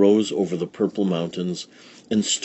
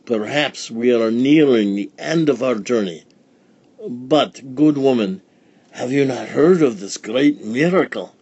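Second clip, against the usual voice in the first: put-on wise old sage; 4 semitones higher, 4 dB louder than usual. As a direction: proclaim it with reverence. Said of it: "cried the wise man".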